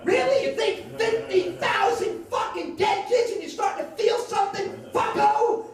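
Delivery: high, loud, indignant rant; outraged yelling. Acted. Protester berates LBJ over dead kids, outraged shout. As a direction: tear into him in disbelief.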